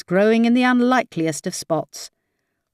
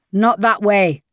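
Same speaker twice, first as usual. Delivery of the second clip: shouted; 2 semitones higher, 4 dB louder than usual. A narrator's reading. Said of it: "Ryan shouted".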